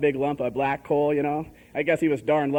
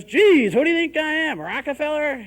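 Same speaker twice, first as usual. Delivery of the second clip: loud, high outburst of fatherly indignation; exasperated dad; 9 semitones higher, 6 dB louder than usual. Retold or imitated. Dad reading the phone bill, loudly exasperated. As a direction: blow up like a dad facing a bill.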